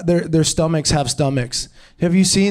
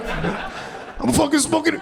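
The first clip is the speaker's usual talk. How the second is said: slow, high, softer mock Italian bear; Italian smoker bear; 10 semitones higher, 5 dB quieter, slower than usual. Comic retries his chain-smoking bear, high mock Italian voice. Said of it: "a chain smoking Italian bear".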